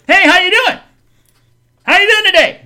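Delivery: high, loud sing-song greeting; bright pushy waitress sing-song; chirpy server voice. Retold or imitated. Server greeting customers at the counter, high sing-song. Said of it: "And he goes".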